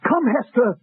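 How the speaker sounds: swept up, rising to a high, grand plea; carried away romance. Acted. [name] overplays wooing Hester, carried away. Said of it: "I got carried away".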